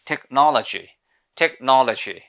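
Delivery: British "টেকনোলজি", stressed rounded NOL, weak second o; British pronunciation. Shown as a demonstration. Teacher models the word for learners, British accent. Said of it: "British people এটাকে উচ্চারণ করে থাকে".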